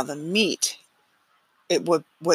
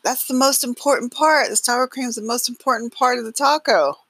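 put-on high, loud kid voice, whining about the sour cream; a boy protesting the missing topping; son's complaint; 8 semitones higher, 3 dB louder than usual. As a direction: play the disappointed son. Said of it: "And he goes".

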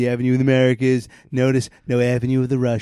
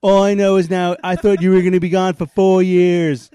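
higher, louder Bill Clinton grumbling at Hillary; Bill Clinton impression; 6 semitones higher, 5 dB louder than usual. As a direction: play Bill complaining to Hillary from the couch. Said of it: "Here's my impression of Bill Clinton".

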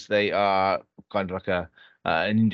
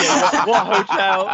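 laughing; 7 semitones higher, 8 dB louder, slower than usual. Retold or imitated. Stern officer voiced in anecdote.

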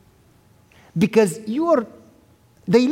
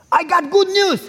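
pitched way up, bursting with news; excited young son calling his father; 9 semitones higher than usual. Retold or imitated. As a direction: blurt it out like a thrilled kid on the phone. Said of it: "you called me excited".

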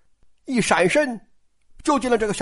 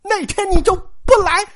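high-pitched indignant demand; acted anger; 8 semitones higher than usual. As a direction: fire it off as an angry accusation. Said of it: "拍桌子就怒了".

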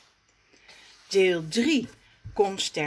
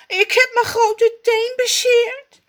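high, loud complaint; whining in pain; 8 dB louder than usual. Acted. Raf, hurt, whines to Rommes, high and loud. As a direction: whine about your sore toe. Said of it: "kermt hij".

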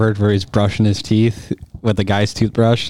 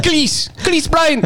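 mock announcer; much slower than usual. Friends doing announcer voices.

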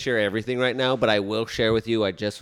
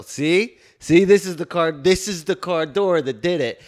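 loud, higher, emphatic proof; acted-out urgent insistence; insistent arguing; 7 semitones higher, 6 dB louder than usual. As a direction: press your case like an amateur investigator. Acts out proving case to police.

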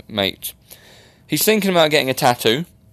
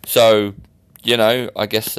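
ordinary talk, nothing marked

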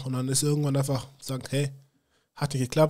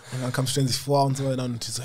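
ordinary talk, nothing marked

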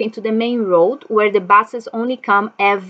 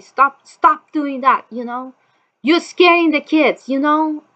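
raised, higher voice pleading; retold plea to stop; 6 semitones higher, 3 dB louder than usual. Uncle shouting through the door at the intruder, raised plea. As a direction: tell him he's scaring the kids. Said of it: "start saying".

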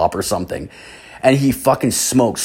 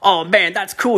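higher put-on dude voice; stoner guy voice; 9 semitones higher than usual. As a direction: play the dopey buddy, pitched up. Same guy voiced in story.

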